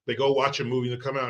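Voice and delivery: ordinary talk, nothing marked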